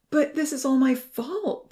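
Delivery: high, slow, upset cry. A narrator's reading. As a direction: blame yourself in distress. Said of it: "Ponzi cried".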